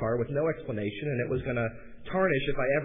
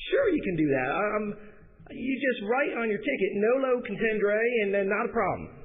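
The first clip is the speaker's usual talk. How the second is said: higher-pitched Southern voice; southern accent; 6 semitones higher than usual. Retold or imitated. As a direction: imitate a Southern US phone voice. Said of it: "an incredibly southern voice and quite winded".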